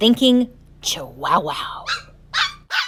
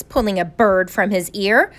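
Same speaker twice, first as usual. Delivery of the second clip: usual speaking voice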